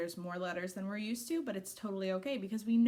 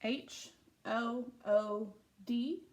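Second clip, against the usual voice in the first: letters spelled slowly one by one; slow spelling; 2 semitones higher, much slower than usual. Shown as a demonstration. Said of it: "spelling letter by letter".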